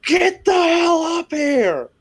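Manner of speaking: very high, drawn-out shout; yell. Acted. Player yelling to a fallen companion, high shout. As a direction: holler it slowly down to someone far below. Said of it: "I'm just gonna yell".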